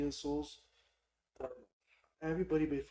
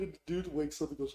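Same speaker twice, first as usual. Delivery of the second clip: ordinary talk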